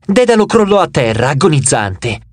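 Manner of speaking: ordinary delivery, nothing marked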